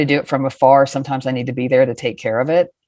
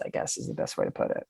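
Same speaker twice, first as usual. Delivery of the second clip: whispered aside; 16 dB quieter, faster than usual. Real, not acted. Trailing aside.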